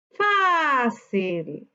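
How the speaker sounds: slow "Fácil", long FÁ falling to cil; stressed syllable stretched. Shown as a demonstration. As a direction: load the voice onto the FÁ of "Fácil". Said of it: "Su sílaba tónica".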